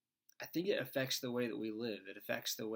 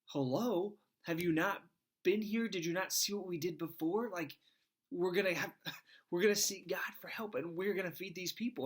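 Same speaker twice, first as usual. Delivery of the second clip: higher, amused disbelief as Jesus; incredulous Jesus; 7 semitones higher, 3 dB louder than usual. Retold or imitated. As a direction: play Jesus baffled by his disciples. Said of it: "if I'm Jesus, like".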